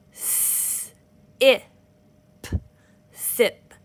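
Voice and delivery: "S, a, p, sap" sound by sound, then blended; one finger per sound. Shown as a demonstration. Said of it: "show you how to break up your words".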